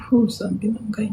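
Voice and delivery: a soft, aching whisper; pained whisper. Real, not acted. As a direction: whisper it as if it still hurts. Telling viewers of her painful homesickness.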